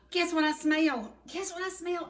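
high and loud, thrilled; excited. Real, not acted. Cook teasing viewers about the cobbler smell, excited.

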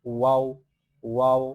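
ওয়াও loud with both lips rounded; rounded, resounding waw. Shown as a demonstration. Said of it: "বিলের মতো আওয়াজ দেবেন".

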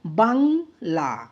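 বাংলা in two held beats, বাং, then লা; syllable by syllable. Shown as a demonstration. Teacher gives a two-syllable example, বাংলা split syllable by syllable. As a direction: break বাংলা after বাং. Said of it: "দুটি দলের ক্ষেত্রে কি".

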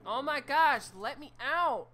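loud high begging to escape; desperate pleading. Real, not acted. Trapped player begging the game to let him out. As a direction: beg loudly to get out.

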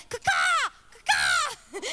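high shouted bird caw; dove squawk. Acted. Preacher imitating the dove flying away, shrill shout. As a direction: squawk like a startled bird flapping off. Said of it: "you lose your dove".

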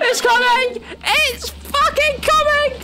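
high panicked yell while fleeing; yelling while running away. Real, not acted. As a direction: scream it out as you run. Fleeing monster in game.

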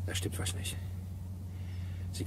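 voiceless, hushed suspicion; quiet whisper of suspicion; suspicious whisper. Acted. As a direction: whisper it as a dawning doubt. Inspector senses something wrong.

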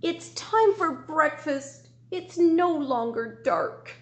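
high, slow barking dog voice. Acted. Teacher reading a farm story, as a high slow dog. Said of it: "who began to bark".

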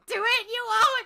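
teasing dare. Real, not acted.

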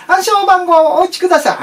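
sing-song automated prompt; ATM voice. Retold or imitated. ATM speaking.

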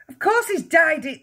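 high, loud exasperated cry. A narrator's reading. Mother contradicts the father, crying out. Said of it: "the mother cried".

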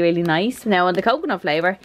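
normal talking voice

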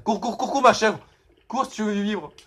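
presse la chèvre, aigu et pressant; panique. Real, not acted. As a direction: pousse la chèvre à fuir, affolé. Fuit le policier en panique. (cris affolés et aigus)

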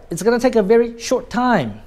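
short said high and brief; stress on short. Shown as a demonstration. Teacher demonstrating to students, short high and brief. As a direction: lift "very short" and keep short tight. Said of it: "When you want to emphasize short".

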